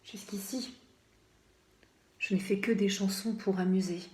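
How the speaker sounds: soft, private reflection; hushed musing. A narrator's reading. Condemned poet alone in his cell, hushed musing. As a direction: murmur it to yourself.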